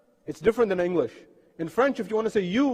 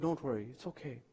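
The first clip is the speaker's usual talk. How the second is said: hushed, lower, soothing; soft reassurance; 5 semitones lower, 14 dB quieter than usual. Retold or imitated. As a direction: comfort gently, very quietly. Man reassures the crying Imam.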